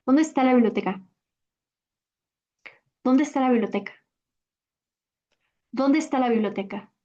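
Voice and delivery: quick "¿Dónde está la biblioteca?" with the vowels fused; casual native flow; fluent question; natural speed. Shown as a demonstration. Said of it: "I'll say it at natural speed".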